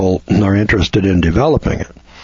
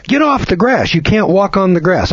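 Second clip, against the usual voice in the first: much higher, shrill-pitched bossy yell; mock guard yelling; 10 semitones higher than usual. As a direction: play a guard shooing a visitor off the grass. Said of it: "And the guard comes over and says".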